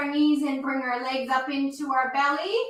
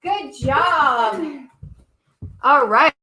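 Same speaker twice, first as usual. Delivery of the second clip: big loud cheer of praise; 6 dB louder, much slower than usual. Real, not acted. Teacher praising children after lunges, loud cheering. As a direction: cheer them on, full voice.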